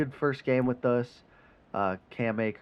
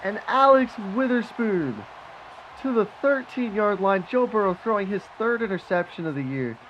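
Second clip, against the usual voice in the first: higher, louder play-by-play, slowing at first; excited call; 9 semitones higher, 4 dB louder than usual. Real, not acted. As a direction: call the return like a hyped commentator. Calling the return live.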